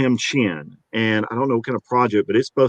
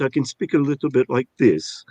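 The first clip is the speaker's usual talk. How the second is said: ordinary talk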